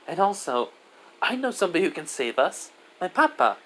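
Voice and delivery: put-on high child voice; hopeful little girl. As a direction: share good news as a small child. Child shares hope of rescue.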